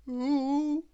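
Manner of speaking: high, lightly wailing ooh; a hammed-up little wail; mock wail. A narrator's reading. Mister Dizzy, lost in the dark, in a mock wail. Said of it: "wailed Mister Dizzy".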